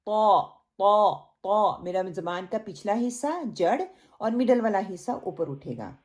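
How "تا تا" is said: طا sounded thick, slow; heavy ta. Shown as a demonstration. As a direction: stretch the heavy طا. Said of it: "تا نہیں کرنا". (موٹا طا)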